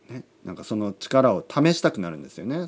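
ordinary delivery

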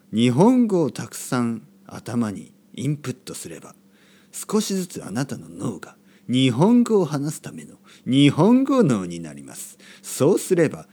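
hammed-up audiobook narration, projected; audiobook narrator. Shown as a demonstration. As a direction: play up the audiobook reader, phrase by phrase. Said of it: "オーディオブックみたいな話し方になりました". (オーディオブック風に大げさに朗読する口調)

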